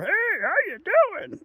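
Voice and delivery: high, slow, sing-song hello; mock bozo greeting. Mocking a bozo who walks in front of his hunting blind, in a high drawn-out greeting. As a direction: squeak it out like a clueless passer-by.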